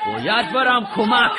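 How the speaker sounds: ordinary talk, nothing marked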